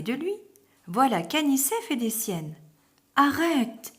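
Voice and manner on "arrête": supplication plus aiguë et plus forte; supplie terrorisé. A narrator's reading. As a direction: implorer l'ami de cesser, apeuré. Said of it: "supplie Minilou terrorisée". (petit loup terrorisé qui supplie)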